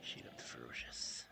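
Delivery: soft whispered "She looked ferocious"; low whispered "ferocious" as if afraid to be heard. Acted. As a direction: breathe "She looked ferocious" as a hushed secret. Whispered take before loud take.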